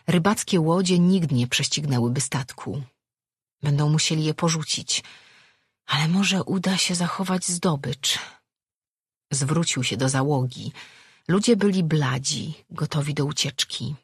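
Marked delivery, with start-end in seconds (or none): none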